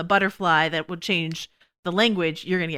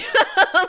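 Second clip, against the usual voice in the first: burst of laughter; 7 dB louder than usual. Real, not acted. Laughing at own joke.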